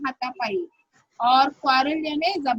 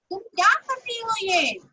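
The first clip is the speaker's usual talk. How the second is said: higher, put-on old grandmother voice; grandmother scolding; 7 semitones higher than usual. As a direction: play the scolding grandmother, pitched up. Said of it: "और उसने कुछ बोलना चाहा".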